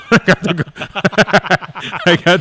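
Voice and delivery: laughing through the words; cracking up mid-sentence. Real, not acted. Laughing while retelling gauze story.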